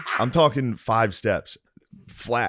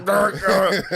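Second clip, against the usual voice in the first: high, louder hammed-up choking grunts; mock heart attack grunt; 11 semitones higher, 4 dB louder than usual. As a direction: play a dad keeling over. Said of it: "It's like".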